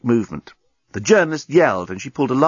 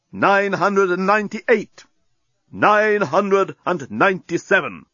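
bomb announcer; 7 semitones higher than usual. A narrator's reading. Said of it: "a voice boomed out".

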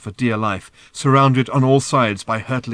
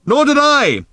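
high, loud yelled agreement; sustained high shout; shouting back; 8 dB louder, slower than usual. A narrator's reading. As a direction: holler it back. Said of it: "shouted Arthur back".

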